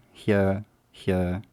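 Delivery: normal talking voice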